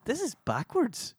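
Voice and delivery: high-pitched, drawn-out disbelief; baffled realisation. Retold or imitated. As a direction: retell a slow, incredulous discovery, voice way up. Retelling baffled reaction to film scene.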